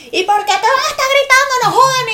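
high, loud mock yell of an upset mother. Mom yelling at her daughter about the boss, loud and high. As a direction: shout it like a fed-up mom. Angry mother voiced in story.

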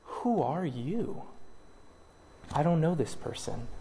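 disown them quietly; cold quiet rejection. Pretend. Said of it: "the Lord Jesus himself will say".

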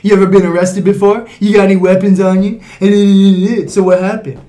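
loud, pushy police questioning; interrogating cop. Acted. Officer harassing a couple walking home, interrogating cop. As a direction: fire questions like a suspicious officer, loudly. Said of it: "No, it'd be more like".

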